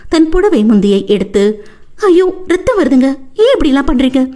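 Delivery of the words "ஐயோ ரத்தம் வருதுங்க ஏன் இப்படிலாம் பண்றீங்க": high, alarmed tearful cry; wife seeing husband bleed, high alarmed sobbing. Acted. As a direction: gasp at his blood. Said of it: "அழுது கொண்டே".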